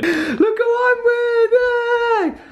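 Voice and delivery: very high, laughing and crying at the reunion; tearful excited squeal. Real, not acted. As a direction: squeal it out, overjoyed and teary.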